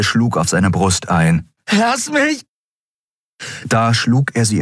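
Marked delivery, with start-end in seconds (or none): 1.7-2.4 high, struggling yell; frantic shout. A narrator's reading. Andriel beats on Kero's chest, frantic scream. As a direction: shout to be let go.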